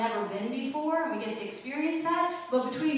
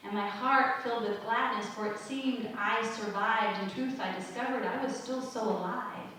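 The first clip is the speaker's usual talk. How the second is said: measured, softer poem read, lower voice; 3 semitones lower, 4 dB quieter than usual. Acted. Preacher reads a sandcastle poem to her listeners, gently.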